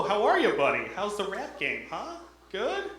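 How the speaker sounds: high, hammed-up fake-friendly rube. Wisconsin local greeting him, high and hammed-up patronizing. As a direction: talk down to him like a clueless local. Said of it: "That's how they say hello".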